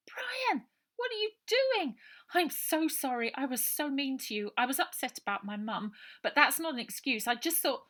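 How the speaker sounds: put-on high, loud girl's voice, apologetic and urgent; a worried girl pouring out an apology; anxious young girl. A narrator's reading. Ida's voice in the story.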